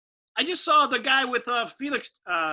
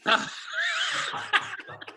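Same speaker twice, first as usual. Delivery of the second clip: airy high-pitched laugh, no voice; breathy high laugh; 5 dB quieter than usual. Real, not acted. Laughs at briefs or boxers.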